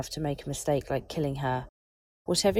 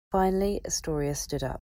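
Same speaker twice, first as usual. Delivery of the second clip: ordinary delivery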